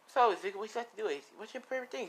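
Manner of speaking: high and fast, falsely chummy; fake sweet. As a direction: rattle off questions in a bright, phony voice. Fake-friendly parents in the car.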